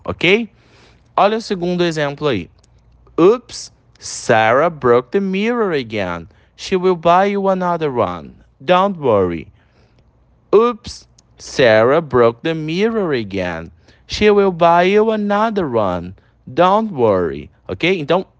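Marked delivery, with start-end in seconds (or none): 3.2-9.5 slow classroom read with a jumped-up oops; slow pronunciation model. Shown as a demonstration. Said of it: "repete a pronúncia em todos". 10.5-17.5 slow classroom read with a jumped-up oops; slow pronunciation model. Shown as a demonstration. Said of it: "repete a pronúncia em todos".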